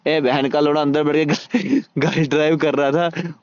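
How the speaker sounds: amused swearing through a real laugh. Real, not acted. Player telling viewers who was driving the car, laughing. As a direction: break into a laugh mid-sentence. Laughs while mocking hidden driver.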